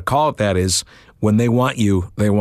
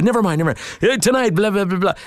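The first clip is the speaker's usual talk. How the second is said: rushed promo read; 10 semitones higher, faster than usual. Retold or imitated.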